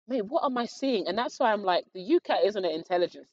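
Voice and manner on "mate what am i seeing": mock bewilderment. Baffled by exam questions.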